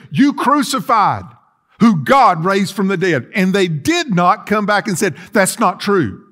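usual speaking voice

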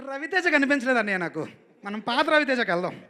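insistent coaxing. Retold or imitated. Director asking the star for his old style, loud and insistent. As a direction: press the star loudly for another take.

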